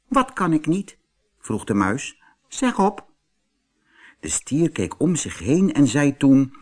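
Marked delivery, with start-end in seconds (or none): 0.1-3.1 very high, light mouse voice; little mouse. Acted. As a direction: squeak it out as a tiny mouse. Mouse dares the bull.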